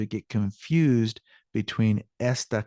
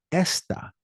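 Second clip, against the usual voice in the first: esta, voice raised on ES; stress on first syllable; 8 semitones higher than usual. Shown as a demonstration. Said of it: "voice raises on that first syllable".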